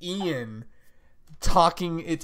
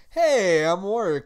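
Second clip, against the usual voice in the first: higher, louder breezy hello; cheery Warwick greeting; 6 semitones higher, 7 dB louder, slower than usual. Retold or imitated. Podcaster imitating Warwick's casual greeting. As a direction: toss it off bright and friendly. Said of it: "he's all like".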